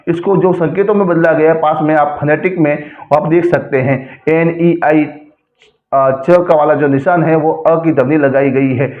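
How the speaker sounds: normal talking voice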